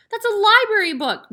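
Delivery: put-on high, loud girl's protest; cried out. A narrator's reading. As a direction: cry out in alarm for the book. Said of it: "Matilda cried".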